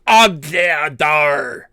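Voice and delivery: slow, exaggerated French accent; mock French accent. Player teasing about French words, slow mock French accent. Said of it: "you're like".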